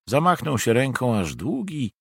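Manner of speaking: usual speaking voice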